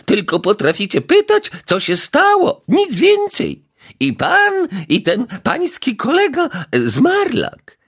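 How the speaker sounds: high, loud reproachful neighbour; scolding old lady. A narrator's reading. Neighbour berates the detective, high shrill reproach. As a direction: lecture the useless detectives.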